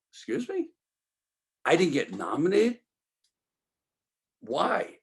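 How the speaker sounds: high, stunned "Excuse me?"; shocked disbelief. Retold or imitated. Told he wasn't nominated, stunned disbelief. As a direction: retell the jolt of hearing the news. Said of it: "I go".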